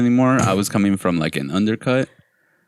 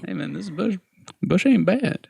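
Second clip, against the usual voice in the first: high Minnesota accent on "bush ain't bad"; joking about an old photo in a high Minnesota accent; 11 semitones higher than usual. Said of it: "that's Minnesota".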